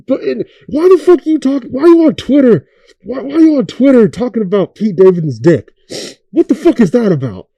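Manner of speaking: high, loud exasperated questions; incredulous rant. Real, not acted. Ranting at [name] over his tweets, loud and incredulous. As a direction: demand answers in disbelief.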